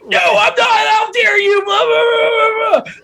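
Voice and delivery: high-pitched, hammed-up outrage; over-the-top indignant mock shout; mock yelling. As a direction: pretend to yell a refusal, dissolving into blah-blah. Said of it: "I'm not gonna yell at you".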